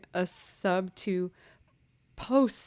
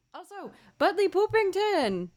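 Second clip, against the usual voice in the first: much higher, louder call of the name; excited greeting; 12 semitones higher, 5 dB louder than usual. Real, not acted. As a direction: announce the name with delight.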